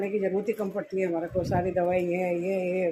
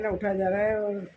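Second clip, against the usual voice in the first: ordinary talk, nothing marked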